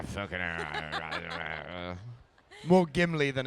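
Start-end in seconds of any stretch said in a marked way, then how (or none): 0.0-2.0 very low, slow, soft grumble; deep dwarvish mutter, dragged out; grumbling dwarf. Retold or imitated. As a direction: growl it under your breath like a grumpy dwarf. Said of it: "standing on a box with my stubble going".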